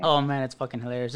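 usual speaking voice

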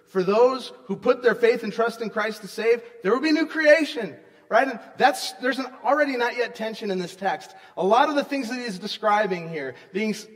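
loud, rising sermon proclamation; preaching at full force. Acted. Pastor proclaiming good news to congregation, loud and fervent. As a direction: preach it out with conviction.

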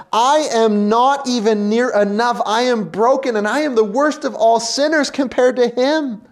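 high, loud cry of brokenness; anguished cry. Acted. Preacher voicing a broken sinner, high and loud. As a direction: cry it out as one undone.

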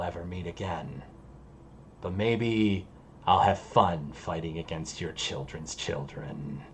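low, hushed demon voice; demon's wistful farewell. Acted. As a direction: bid old allies farewell softly and low. Game master plays the demon Anavel.

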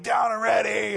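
high, loud shout to the field; coach calling out. Retold or imitated. Coach calling to inattentive little girls, imitated high shout. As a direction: holler it like a coach.

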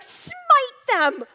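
loud, high, hammed-up venting; mock angry prayer. Retelling her frustrated prayer, mock angry shout. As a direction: rant at God, half joking. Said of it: "I was like".

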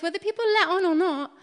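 ordinary talk

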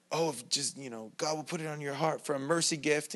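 softly voiced televangelist pitch; a hint of a smooth preacher asking for money; mock televangelist. Preacher mocking a televangelist's plea, lightly voiced. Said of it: "televangelist here".

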